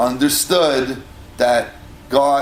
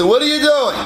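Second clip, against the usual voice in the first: demanding; 11 semitones higher, faster than usual. Retold or imitated. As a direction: bark the question at a suspect. Romans interrogate the Kuti.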